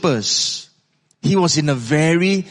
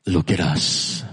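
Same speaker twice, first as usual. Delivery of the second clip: low, solemn command; deep apostolic pronouncement; Peter; 10 semitones lower, 3 dB quieter than usual. Acted. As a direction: say it deep and steady, with authority. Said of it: "Peter's voice spoke out".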